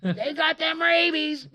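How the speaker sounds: high, drawn-out holler; yelling lady. Retold or imitated. Woman yelling from the roadside houses, shrill panicked yell. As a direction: scream a warning, stretching it out loud. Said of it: "this one lady just yelling".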